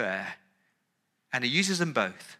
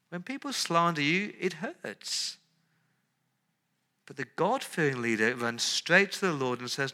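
ordinary talk

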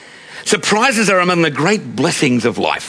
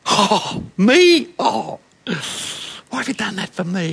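mock humility; 3 semitones higher than usual. Adult receiving a surprise, coy mock humility. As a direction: play the coy, falsely modest gift receiver. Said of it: "there's mock humility".

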